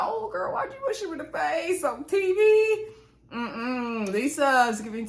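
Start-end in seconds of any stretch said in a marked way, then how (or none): 0.0-2.8 much higher, exclaiming in disbelief; girl-you-didn't high disbelief; shocked reaction. Retold or imitated. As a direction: squeal out your shock at the TV. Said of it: "So I was like".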